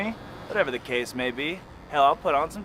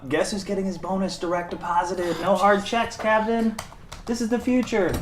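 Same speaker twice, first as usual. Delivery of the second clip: rising proud hype; excited boasting; 6 semitones higher than usual. Acted. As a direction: sell it like a triumph. Boss boasting about scheme.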